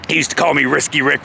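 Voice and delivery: usual speaking voice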